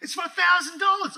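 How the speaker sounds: put-on calling the boys, overjoyed and loud; excited shouting. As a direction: burst in yelling with joy over the check. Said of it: "I'm, I'm excited".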